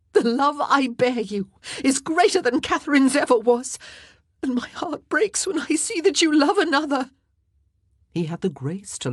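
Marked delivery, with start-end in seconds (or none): 0.1-7.1 high, loud, tearful pleading; crying. A narrator's reading. Wife tells husband her heart breaks, crying. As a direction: heartbroken outburst through tears. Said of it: "Anne was crying uncontrollably now".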